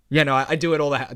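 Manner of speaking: usual speaking voice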